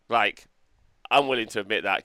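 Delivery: ordinary talk, nothing marked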